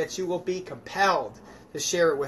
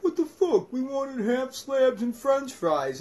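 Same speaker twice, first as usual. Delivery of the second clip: high, slow outraged crowd voice; outraged townsfolk; 8 semitones higher than usual. Acted. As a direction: play the crowd who wanted pork. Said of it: "everyone's like".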